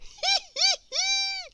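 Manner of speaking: very high tiny laugh; a bright little mouse laugh; squeaky mouse giggle. Acted. Squeak laughing to teach Grizzle, squeaky mouse laugh. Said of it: "Laughed Squeak".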